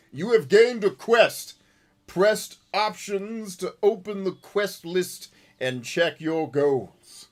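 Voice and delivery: booming, exaggerated game-tutorial read; loud, drawn-out mock instructions; mock tutorial announcer. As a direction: read the on-screen text like an overblown announcer.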